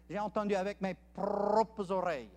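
lightly done West African French, R rolled. Retold or imitated. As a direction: roll the R in an African French accent. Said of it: "when they roll that R".

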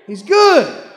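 loud, high, ringing he's good; shouted praise. Real, not acted. Preacher to the church, shouting that God is good. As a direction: belt it out to the back pew. Echo after the shout, then hushed.

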